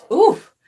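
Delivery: sharp high oof, holding back tears; a pained, loud oof as emotion hits; emotional oof. Real, not acted. On the verge of tears, a loud pained oof. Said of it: "I've been about to cry".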